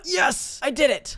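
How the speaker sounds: high, elated outburst; real relieved excitement; triumphant cheer. Real, not acted. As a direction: celebrate a hard-won success. Celebrates finally finishing a section.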